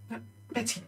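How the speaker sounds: hushed, urgent correction; small voice, trick going wrong; embarrassed young trainer. Acted. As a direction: quietly plead with the dog. Girl's dog trick is failing.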